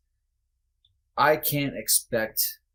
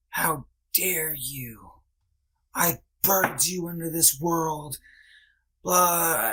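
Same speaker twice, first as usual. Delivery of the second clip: slow, higher mock of his mother's tearful rebuke; mocking crying mother; 6 semitones higher, slower than usual. Son mimics his mother crying down the phone, mock-tearful and high. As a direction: parody her tearful guilt trip, drawn out and pitched up. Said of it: "she called me crying one day and she was like".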